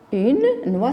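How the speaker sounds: noix ending on a wa sound; silent final X. Shown as a demonstration. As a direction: leave the X of noix unsounded. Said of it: "le X non prononcé". (noix avec le X muet)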